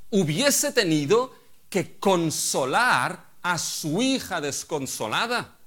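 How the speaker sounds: loud, high fiery sermon; fired-up pulpit proclamation; preaching at full force. Acted. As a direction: thunder it at the congregation. Preaching on justice.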